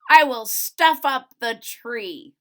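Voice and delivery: loud, sly Grinch boast; gloating Grinch. Acted. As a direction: announce the theft with a grin. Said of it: "grinned the Grinch".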